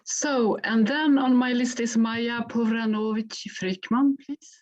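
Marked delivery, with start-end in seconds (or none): none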